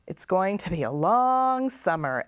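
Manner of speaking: ordinary delivery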